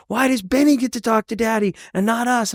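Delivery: high-pitched mock kid whine; crying kids imitated. Jealous siblings to their dad, imitated crying complaint. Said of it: "they're all crying".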